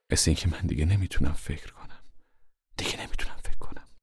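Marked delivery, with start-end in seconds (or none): 2.8-3.9 whispered, lost in thought; dazed whispered repeat. Acted.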